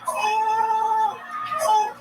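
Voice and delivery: high, rising yelping oh; panicked dog whimper. Retold or imitated. Frightened dog howling at the noise, yelping. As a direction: yelp like a scared dog.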